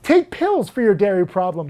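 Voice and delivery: ordinary talk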